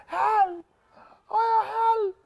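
high whimpering calls for help; sleep-talking cries. Acted. Dreaming boy calling his mother, high frightened whimper. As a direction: cry out weakly in a nightmare. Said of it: "he's closing his eyes".

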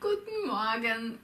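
leicht gespielter Gruß vor dem Spiegel; freundlicher Gruß. Shown as a demonstration. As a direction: sag freundlich guten Morgen zum Spiegelbild. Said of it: "Begrüßt euch freundlich". (Schülerin grüßt sich selbst im Spiegel, freundlich)

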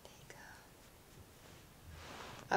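ordinary talk

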